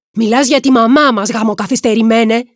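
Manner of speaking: loud, high-pitched angry shout; angry outburst. A narrator's reading. Angry brother insults Brad over mother. (θυμωμένη φωνή, δυνατά και ψηλά)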